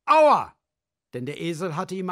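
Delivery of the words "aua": much higher, loud ouch; pained yell. Acted. Ox yells after the donkey kicks him, high and loud. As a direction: cry out after a kick. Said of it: "schrie er".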